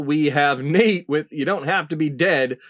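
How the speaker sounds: ordinary talk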